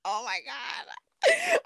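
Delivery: slow, high, stretched out laughing wail; real helpless laughing cry. Real, not acted. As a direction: wail it while dissolving in laughter. Cracking up at the memory.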